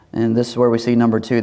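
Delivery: usual speaking voice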